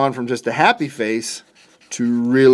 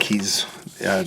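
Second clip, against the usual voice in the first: whisper; 6 semitones lower, 9 dB quieter than usual. Real, not acted. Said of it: "whisper measured".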